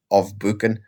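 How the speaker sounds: ordinary delivery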